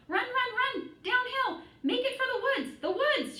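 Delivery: high, loud goose holler. A narrator's reading. Goose hollering at Wilbur to flee, high loud shout. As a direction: shout him toward the woods, way up high. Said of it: "she started hollering".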